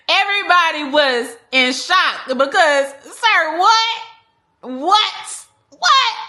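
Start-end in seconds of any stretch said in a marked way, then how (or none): 3.0-6.2 loud, high repeated whats; mock outraged family; sir, what, yelped high and louder each time; shocked disbelief. Family's shocked reaction retold.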